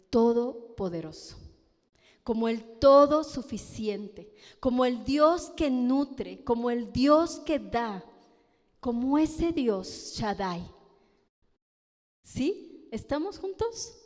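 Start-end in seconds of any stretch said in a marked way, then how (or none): 0.1-10.6 loud proclaiming sermon voice; full-force preacher proclamation; preaching at full force. Acted. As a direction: thunder out each name of God, louder.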